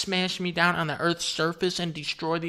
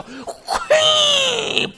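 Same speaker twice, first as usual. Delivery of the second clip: very high, loud squeal; piercing mock donkey squeal; imitated blast squeal; 6 dB louder than usual. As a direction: let out a shrieking battle noise.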